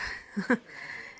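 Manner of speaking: soft airy little laugh; shy breathy giggle. Acted. Nurse flustered by the patient's gaze, soft giggle. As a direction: giggle under your breath.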